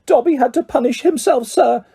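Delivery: high, fluttering elf voice; a small creature overjoyed to meet his hero; eager house elf. A narrator's reading. Elf meeting Harry Potter at last, high and eager.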